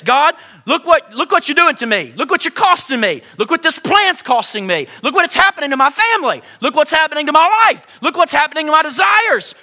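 high, loud aggrieved wail at God; shouted lament, look what you've done; mock complaint to God. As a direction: howl it like a wronged child. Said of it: "start talking like this".

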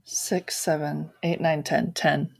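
counting dice under her breath; hushed count while rolling; whispered counting. Real, not acted. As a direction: whisper the numbers.

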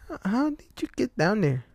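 put-on high, timid child voice; curious little boy. A narrator's reading. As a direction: ask it like a small kid. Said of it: "George asks the clown".

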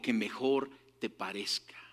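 breathy hushed whisper of a sacred promise; a secret holy whisper. Acted. Quoting God's promise, hushed.

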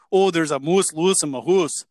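imitated mock-Canadian with rounded "oo"; exaggerated Canadian vowels on "hoose"; Canadian accent. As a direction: imitate a brother's silly Canadian catchphrase. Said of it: "his favorite thing to say".

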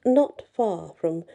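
gentle storyteller reading a fairy tale; story narration. A narrator's reading. Storyteller to listeners, reading Andersen's tale gently.

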